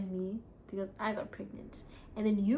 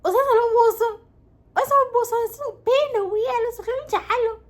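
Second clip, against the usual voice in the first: parents' warning; 13 semitones higher, 13 dB louder than usual. Retold or imitated. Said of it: "abazali bethu bathi".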